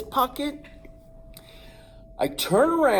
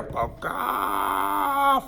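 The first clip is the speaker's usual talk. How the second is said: much higher, shouted bakak; rooster shriek; 10 semitones higher than usual. Retold or imitated. The rooster leaps at the boy, screeching much higher.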